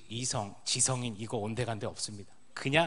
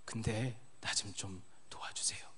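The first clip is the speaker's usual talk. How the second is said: whispered plea; 4 semitones lower, 7 dB quieter than usual. Pretend. Deaf patient begging the teacher for help, in a whisper. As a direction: let the plea sink to a whisper.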